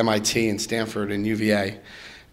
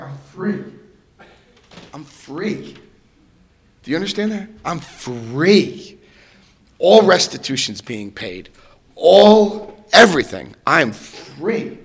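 high, wondering declaration of freedom; emotional joyful release; elated; 5 semitones higher, 6 dB louder, slower than usual. Retold or imitated. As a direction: savor being free. Telling mother he's free.

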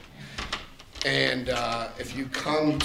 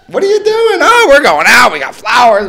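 loud, high mock-gushing voice, easing down; hammed-up excitement; 12 semitones higher, 12 dB louder than usual. Couple telling sister their anniversary plans, hammed-up excitement. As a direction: act out an over-excited phone chat.

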